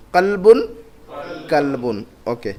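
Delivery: ordinary delivery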